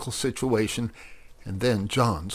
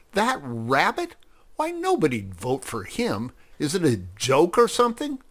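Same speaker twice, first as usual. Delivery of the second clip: higher, disbelieving questions; incredulous scoffing; 6 semitones higher than usual. A narrator's reading. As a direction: scoff at an absurd idea. Freddy scoffs at rabbit candidate.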